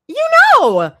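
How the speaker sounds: high, loud mocking singsong; mocking echo. A narrator's reading. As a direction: throw her words back at her. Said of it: "teasing echo, mimicking".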